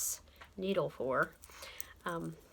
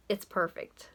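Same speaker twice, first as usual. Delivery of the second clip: ordinary delivery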